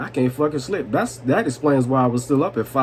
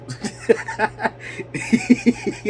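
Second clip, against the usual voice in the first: long, breathless high laugh; wheezing laugh; 10 semitones higher than usual. Real, not acted. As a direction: crack up at your own slip. Laughs at own pronunciation.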